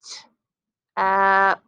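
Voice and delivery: slow blending sounds; slow sliding sounds. Shown as a demonstration. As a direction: slide through each sound, held long. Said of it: "Try it again".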